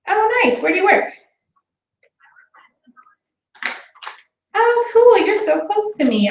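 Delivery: high, bright, eager phone voice; bubbly sales call. Shown as a demonstration. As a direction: sell it with big cheerful enthusiasm. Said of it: "I wanna hear you again".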